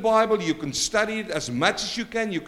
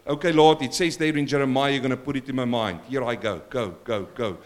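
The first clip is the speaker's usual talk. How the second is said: mock lazy believer; 6 semitones lower than usual. Preacher mocking a lazy believer claiming verses, offhand. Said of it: "I'm gonna sit there now and go".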